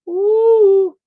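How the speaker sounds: loud high held falsetto ooh; long loud high ooh; Darren Fleet ooh. Retold or imitated. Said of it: "In my Darren Fleet voice".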